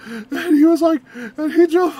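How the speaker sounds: normal talking voice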